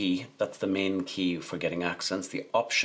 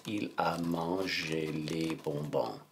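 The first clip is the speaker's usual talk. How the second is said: slow, stretched French at writing speed; slow dictation; 2 semitones lower, 4 dB quieter, slower than usual. Shown as a demonstration. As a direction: draw out each word as you write. Dictating the sentence while writing it.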